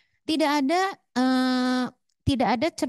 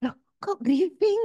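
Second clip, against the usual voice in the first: slow, higher, louder surprised "Loh"; surprised client; 8 semitones higher, 6 dB louder, slower than usual. Retold or imitated. Counselor retelling her pregnant client's startled reply, surprised. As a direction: act out the client's startled question. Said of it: "Terus dia langsung".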